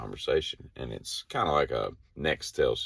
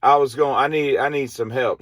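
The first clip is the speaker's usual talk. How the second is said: high, louder call for help on the radio; radio request; 11 semitones higher, 6 dB louder than usual. Retold or imitated. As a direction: ask for help over the walkie-talkie. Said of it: "She's like".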